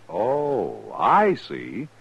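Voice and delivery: ordinary talk, nothing marked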